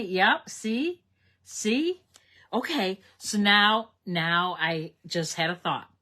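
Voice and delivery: bursting with a sudden idea; excited idea. Real, not acted. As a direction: pitch it like a fun plan.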